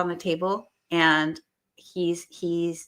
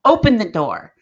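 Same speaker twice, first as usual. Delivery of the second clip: insistent; 5 semitones higher, 11 dB louder than usual. Retold or imitated. Urging repeated louder.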